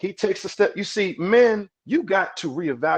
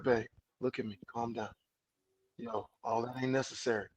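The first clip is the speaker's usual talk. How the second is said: much softer and lower, gentle; soothing calm-down; 6 semitones lower, 15 dB quieter than usual. Retold or imitated. Man soothing his angry partner, soft and low. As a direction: hush her gently so she calms. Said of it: "and then you'll be like".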